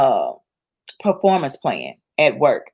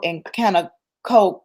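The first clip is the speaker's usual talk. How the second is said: ordinary delivery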